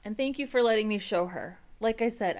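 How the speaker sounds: ordinary talk, nothing marked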